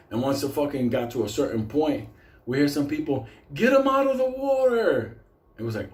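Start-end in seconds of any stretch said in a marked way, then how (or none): 3.5-5.2 calling out. Retold or imitated. Camper yelling to save man in water, urgent holler. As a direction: call it high across the river.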